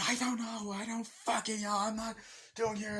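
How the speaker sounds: high, flustered mock wife. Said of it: "Jamie Lee Curtis is like".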